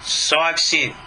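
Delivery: ordinary delivery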